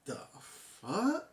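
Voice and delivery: soft, slow, drawn-out disbelieving whisper of a curse; hushed, slowed-down shock; stunned disbelief. Retold or imitated. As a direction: let it fall out of you, quiet and stunned. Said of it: "And I was like".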